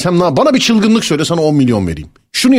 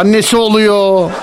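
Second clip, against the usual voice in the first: higher, slow and stretched out; drawn-out teasing; 6 semitones higher, slower than usual. Real, not acted. Host naming the caller's mother as relative, drawn-out teasing. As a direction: hold the last word long, needling.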